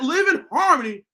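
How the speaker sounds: ordinary talk, nothing marked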